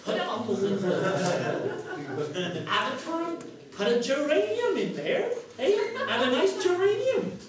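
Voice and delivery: high, loud, hammed-up old countrywoman; old woman's accent. Storyteller plays washerwoman planning a geranium pot, broad old-woman accent. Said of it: "Sorry about the accent".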